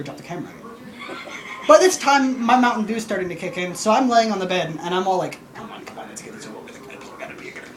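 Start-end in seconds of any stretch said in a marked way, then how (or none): 5.5-7.8 hurried whispered pleading; whispering to the crew, desperate to pee; urgent whisper. Retold or imitated. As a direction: whisper it like you can't hold it. Impatient on bed, needing to pee.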